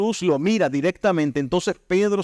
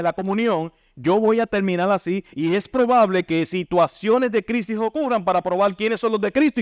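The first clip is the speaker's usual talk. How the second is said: higher, driving sermon voice, pressing each point home; fiery preaching; 6 semitones higher than usual. Acted. Preacher warning the congregation about backsliding, impassioned. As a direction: preach it with growing fervour to the church.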